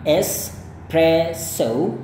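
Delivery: very slow British espresso, rising tune. Shown as a demonstration. Teacher slowly models the British espresso. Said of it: "itu gaya British".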